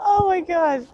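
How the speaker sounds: loud high laugh into oh my God; big laugh. Real, not acted. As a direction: laugh out loud, then gasp the words. Laughing at own story.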